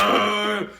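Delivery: loud, high drawn-out wail; hammed-up cry of drunken dismay; mock drunken wail. Drunk man turned away at the gas station, mock wail.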